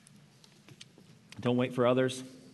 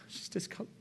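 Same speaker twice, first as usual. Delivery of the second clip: breathless whisper of retold panic; whispered dread from the phone call; hushed panic; 7 semitones higher, 10 dB quieter than usual. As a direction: let the fear drop to a whisper. Retelling wife's panicked phone call.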